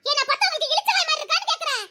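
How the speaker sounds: high-pitched, outraged demand; indignant outburst. Acted. Woman objecting as the couple leaves, outraged. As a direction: protest at being taken for a fool.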